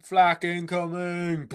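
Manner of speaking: slow, drawn-out alarm call; mock battle warning. Co-host punning on flack, mock battle warning. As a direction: call it out like incoming fire.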